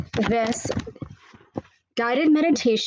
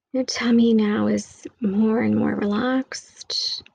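whisper. Acted. Whispered meditation line.

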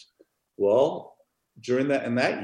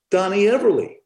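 put-on indignant old woman; 6 semitones higher, 4 dB louder than usual. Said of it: "She goes".

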